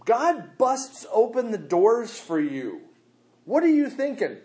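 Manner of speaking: high, loud fervent preaching; fired-up pulpit delivery; full-force preaching. Acted. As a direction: preach it to the rafters.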